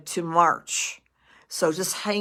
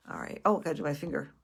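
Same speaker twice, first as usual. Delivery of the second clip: ordinary talk, nothing marked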